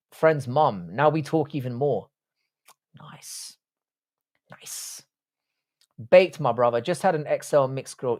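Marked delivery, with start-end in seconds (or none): 3.0-3.6 breathy whispered nice. Real, not acted. Muttered reaction to chat. 4.5-5.0 whispered. Real, not acted. Muttered reaction to chat.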